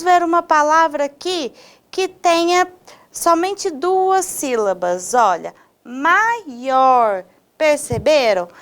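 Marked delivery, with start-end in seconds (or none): 5.8-7.2 MAIOR slowly split, mai-OR, leaning on OR; stressed final syllable. Shown as a demonstration. Teacher showing a two-syllable word, slow MAIOR stressing OR. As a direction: stretch MAIOR in two beats, the second stronger. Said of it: "com uma tonicidade mais forte".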